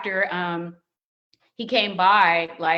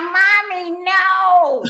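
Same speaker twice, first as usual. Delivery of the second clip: put-on high, loud little child's protest; toddler son; 11 semitones higher, 9 dB louder, much slower than usual. The toddler son interrupting the proposal, high loud child protest. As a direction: play the baby barging in. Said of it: "our son comes in, he's like".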